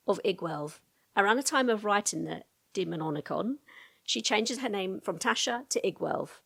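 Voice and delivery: ordinary talk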